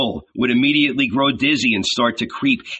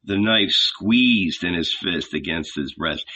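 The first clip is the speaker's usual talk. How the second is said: ordinary delivery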